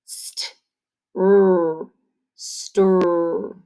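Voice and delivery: whispered "St", then "r", then "stir" whole; chunked blend demo. Shown as a demonstration. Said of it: "chunk it up".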